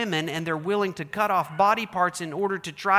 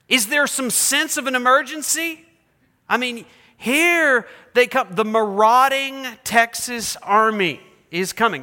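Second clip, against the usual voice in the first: loud, urgent, higher-pitched warning; alarmed urgency; 5 semitones higher, 6 dB louder than usual. Shown as a demonstration. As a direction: sound the alarm. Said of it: "That's the sense of an emergency".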